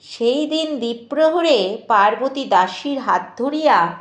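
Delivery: ordinary delivery, nothing marked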